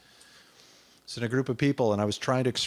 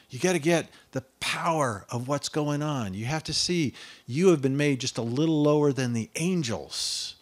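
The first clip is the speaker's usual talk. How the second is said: ordinary delivery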